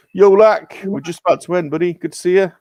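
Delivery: calling out cheerfully, loudly; big loud hello to a friend; loud greeting. Real, not acted. Greeting a returning stream guest.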